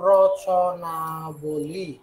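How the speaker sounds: "Rachanabali" stretched very slowly, syllable by syllable; drawn-out word. Shown as a demonstration. Teacher writes word out while saying. ("রচনাবলী" খুব ধীরে টেনে টেনে)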